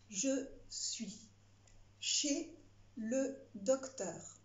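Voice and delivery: slow, word by word dictation; dictating. Shown as a demonstration. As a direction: stretch out le docteur slowly. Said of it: "C'est parti".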